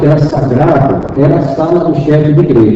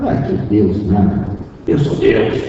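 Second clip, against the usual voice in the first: low, quiet, slow spirit voice claiming to be God; a hint of a man's voice, low and hushed; possessed woman's voice; 8 semitones lower, 8 dB quieter, slower than usual. Possessed woman tells the minister she is God, low and hushed.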